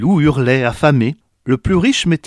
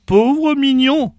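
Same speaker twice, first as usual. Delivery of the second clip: high, slow pitying exclamation; old woman's pity; 13 semitones higher, slower than usual. A narrator's reading. Old woman pities the crying baby, high and slow. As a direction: coo over the crying baby. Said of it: "s'exclama la vieille au bois mort".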